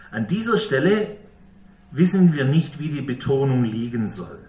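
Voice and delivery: ordinary talk, nothing marked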